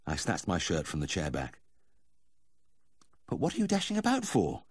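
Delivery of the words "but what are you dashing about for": lightly voiced high-pitched wife's question; querying wife. A narrator's reading. Wife woken by the vet's fall, mildly exasperated questioning.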